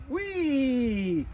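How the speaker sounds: put-on a child's delighted whee; gleeful whee. A narrator's reading. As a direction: squeal with joy sliding down. Playing in the snow.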